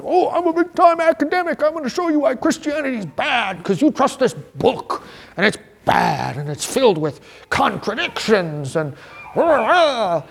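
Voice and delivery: high, sneering know-it-all voice; mock smug academic. Mocking the skeptic academic.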